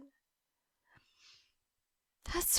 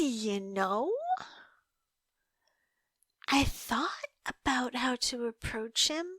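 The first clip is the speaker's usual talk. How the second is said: ordinary talk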